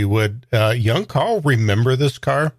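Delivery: ordinary talk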